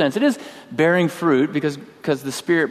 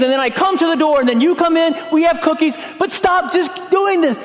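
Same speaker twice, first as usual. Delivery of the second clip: much higher, louder, furious shout; angry yell; 4 dB louder than usual. Retold or imitated. To the little girl ringing the doorbell, furious yelling. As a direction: blow up at the kid on the doorstep. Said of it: "and I'm yelling".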